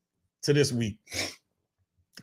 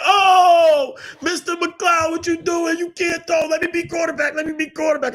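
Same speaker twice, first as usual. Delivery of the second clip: high, shouting kids mocking coach; loud high kid voices teasing; 4 dB louder than usual. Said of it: "They're like".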